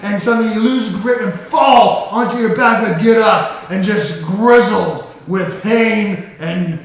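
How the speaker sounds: loud, driving action narration; epic battle narration. A narrator's reading. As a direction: build the fight up big. Dramatic combat narration.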